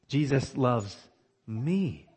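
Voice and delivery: pause, then "me" standing alone and drawn out; stress on me. Shown as a demonstration. Preacher repeating the sentence for emphasis, stress on "me". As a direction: hold back, then land on the word "me". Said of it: "emphasizing a different word each time".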